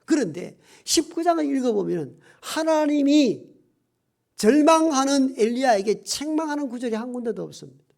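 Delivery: ordinary talk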